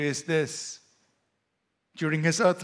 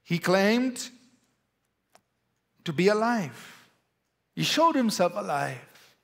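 ordinary delivery

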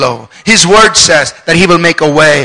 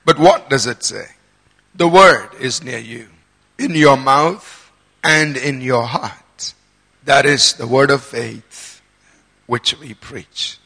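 scripture reading; 4 semitones lower, 6 dB quieter than usual. Acted. Preacher reads Paul's words to the congregation, measured scripture reading.

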